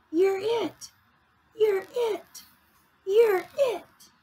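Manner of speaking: very high little-fish voice; imitated tag calls. Reader imitates the fish playing tag, high-voiced. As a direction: mimic small kids calling in a game.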